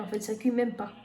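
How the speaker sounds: normal talking voice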